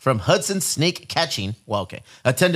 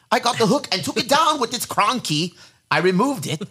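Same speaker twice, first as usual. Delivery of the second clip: a light hint of an Australian accent, higher; lightly voiced Aussie snake catcher; 7 semitones higher than usual. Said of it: "do my best quick for this accent".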